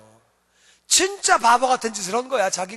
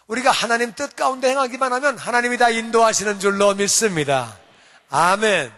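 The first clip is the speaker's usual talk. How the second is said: preaching; 4 dB louder than usual. Real, not acted. Closing prayer-like declaration.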